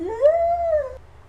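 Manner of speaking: very high, loud silly squeal-laugh; dotty high giggle. Acted. Sister delighted about her unicorns, shrill silly giggle. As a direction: let out a piercing put-on girly giggle.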